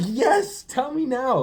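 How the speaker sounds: high, loud begging cry; mock desperate plea. Pleading with God for an answer, high loud begging. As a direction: beg for an answer at the top of the voice.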